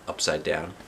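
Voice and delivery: plain reading voice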